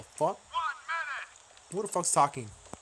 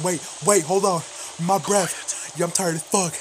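normal talking voice

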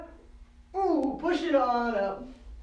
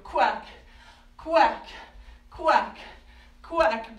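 loud, punchy duck quacking; duck quacks; 3 semitones higher, 4 dB louder, much slower than usual. Pretend. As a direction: quack out loud to get the kids quacking along. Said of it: "I can't hear you".

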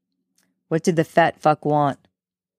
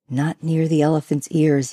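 ordinary delivery

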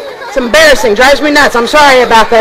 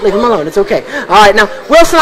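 normal talking voice

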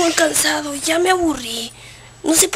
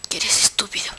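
whispered insult; 9 semitones lower, 6 dB quieter than usual. Acted. Insulting the crying character.

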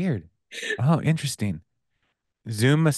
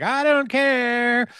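slow high mock reply; much slower than usual. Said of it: "It's like".

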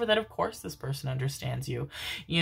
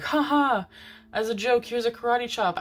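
higher, jokey imitation of a friend; mock playful friend; 6 semitones higher than usual. Retelling a friend's karate chop joke, playful imitation. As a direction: imitate a friend kidding around, pitched up.

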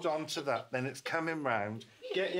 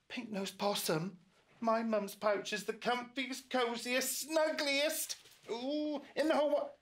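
put-on raised, eager young voice; high excited little voice; 10 semitones higher than usual. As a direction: sing it up high like a small joey. Roo calls to possum.

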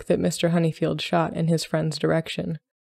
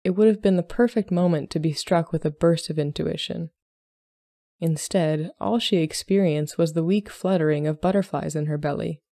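ordinary delivery

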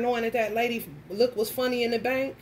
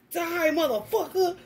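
higher, hammed-up action-movie line; mock action hero; 6 semitones higher than usual. Spraying Windex at the beetle like a gun, mock action hero. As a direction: play the gunslinger firing.

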